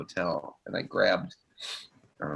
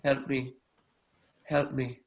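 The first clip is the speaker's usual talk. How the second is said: faint call for help; 4 semitones higher than usual. Retold or imitated. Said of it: "I just heard a voice that said".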